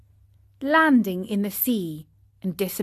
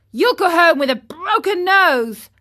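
high, loud escalating threat; threatening; 9 semitones higher, 7 dB louder than usual. Acted. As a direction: raise the voice and threaten him. Boys threaten the puppet.

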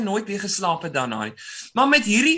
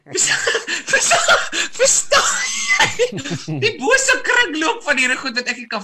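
higher, louder words through real laughter; laughing shout; 9 semitones higher, 5 dB louder, slower than usual. Real, not acted. Host laughing at the tortilla prank story, shouting through laughter. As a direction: crack up while still trying to make the point. Host cracks up mid-rant.

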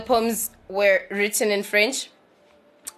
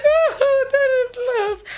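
swooning delight. Pretend. Reacting to scary-sounding German, gushing in mock swoon. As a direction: gush over it like it's romance. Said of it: "I'd be like".

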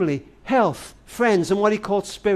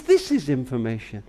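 operator voice; 7 semitones lower, 5 dB quieter than usual. Retold or imitated. The operator answers the grown man.

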